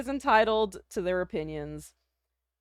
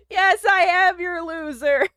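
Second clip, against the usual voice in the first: laughing; 7 semitones higher, 5 dB louder, slower than usual. Real, not acted. Laughing while admitting it.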